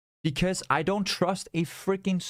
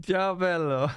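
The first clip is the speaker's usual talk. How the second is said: a bigger mock Italian ciao, still laughing; hammed-up Italian greeting; 2 semitones higher, slower than usual. Laughing at his German slip, greets the Italian viewer hammily. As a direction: lean into the Italian accent, amused at himself.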